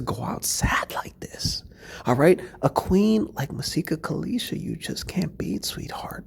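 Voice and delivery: whispering a pretend consolation to Brittany; mock whisper. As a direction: whisper it like breaking bad news gently.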